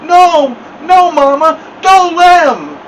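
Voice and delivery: high, loud muffled protest; mumbling. A narrator's reading. Stuck boy begs Mama not to let them pull, mumbling. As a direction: wail with lips stuck fast.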